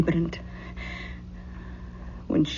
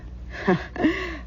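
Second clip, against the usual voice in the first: soft fond laugh; 10 semitones higher, 5 dB quieter than usual. Acted.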